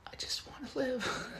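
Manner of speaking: high, hushed whisper as the abandoned plant; plant's tiny plea. Retold or imitated. Plant begging to live, high whispered plea. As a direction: breathe it like a fragile dying plant. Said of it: "That plant must be like".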